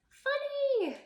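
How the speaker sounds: high, loud, playful 'funny'; silly word prompt. Shown as a demonstration. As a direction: sing out the word high for kids. Demonstrates silly memory prompt.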